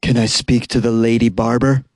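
husky, creepy prank-caller voice; low, husky voice. Acted. Prank caller asking a barbershop, low husky voice. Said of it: "and then a low, husky voice asks".

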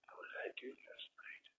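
whispered, barely audible, all breath; hungover whisper. Retold or imitated. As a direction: whisper it like you just woke up somewhere strange. Waking up hungover, quoting himself.

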